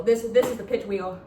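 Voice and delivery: normal talking voice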